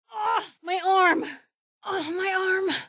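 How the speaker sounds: high cries of pain; pain cry. Acted. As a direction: cry out, clutching the arm. Arm crippled in combat.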